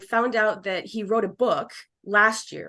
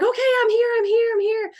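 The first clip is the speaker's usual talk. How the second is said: much higher, louder, light eager voice; spirit answering; 14 semitones higher, 4 dB louder than usual. Retold or imitated. Spirit replying to the medium, much higher and louder. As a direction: answer brightly, pitched way up. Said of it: "He's like".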